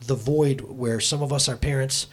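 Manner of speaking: soft, low heartfelt prayer. Acted. Pastor praying over the congregation, soft and low. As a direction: pray it quietly for the room.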